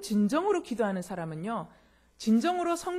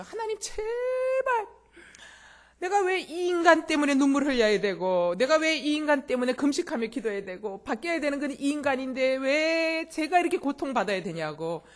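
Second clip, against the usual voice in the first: imitated pleading lament; 5 semitones higher, 4 dB louder than usual. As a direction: act out a long-suffering wife pouring out grievances to God. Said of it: "막 하소연하면서 기도합니다".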